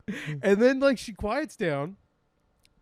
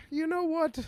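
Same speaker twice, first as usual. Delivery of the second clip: high, lightly voiced grandma; offhand high-pitched grandma impression; imitated grandma; 12 semitones higher than usual. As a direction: imitate a grandma piping up at dinner. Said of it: "my grandma just, like, doesn't look up".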